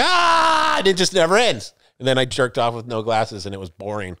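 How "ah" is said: high loud heartburn cry; high mock cry of pain; pained yell. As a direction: yelp at a sudden pain. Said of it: "And I was like".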